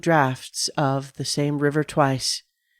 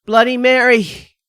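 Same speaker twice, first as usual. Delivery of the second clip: yelled, higher and louder; yelling; 8 semitones higher, 7 dB louder than usual. A narrator's reading. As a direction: yell the name at the mirror.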